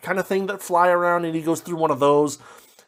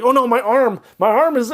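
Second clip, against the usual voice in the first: hammed-up alarm, much higher and louder; mock-frightened cry, pitched high; mock panic; 8 semitones higher, 5 dB louder than usual. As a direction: play at sudden horror over a small detail. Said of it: "and he's like".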